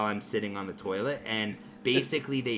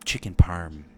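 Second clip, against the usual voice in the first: lower, whispered devil voice; devil on shoulder; 7 semitones lower than usual. Retold or imitated. The devil on his shoulder taunting him over the chicken, low whisper. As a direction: hiss it like a devil in his ear. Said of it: "the devil on my shoulder".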